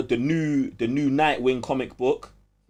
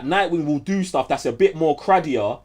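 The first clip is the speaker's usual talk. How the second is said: ordinary delivery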